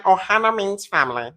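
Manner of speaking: high mocking cartoon voice; exaggerated put-on creature voice; Stitch impression. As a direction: quote it as a cartoon alien, teasing. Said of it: "you sound like Stitch".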